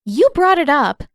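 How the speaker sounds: loud, high pointed retort; defiant retort. Acted. Katara talking back to stern Pakku. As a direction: snap it back at him.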